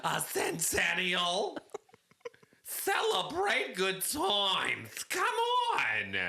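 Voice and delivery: slow, stretched-out showy celebrating; hammed-up caller belting out congratulations; [name]. Special caller celebrating the show's centennial, drawn-out [name] voice. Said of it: "It's [name]!".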